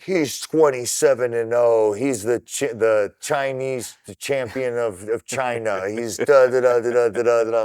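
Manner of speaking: boxing ring announcer, booming a fighter's record; mock ring announcer. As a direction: ham up a fight commentator reading a long record. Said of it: "they start doing the commentary".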